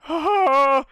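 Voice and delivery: high, loud acted gasp; big hammed-up "ah" of excitement; excited gasping cry. GM imitates Foji heard on phone.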